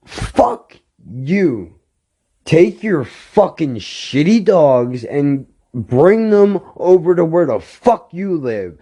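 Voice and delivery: heated rant with pitch riding high; retold angry outburst. Telling the dog owner to stay away, angry. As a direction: act out telling someone off, hammering the swear words.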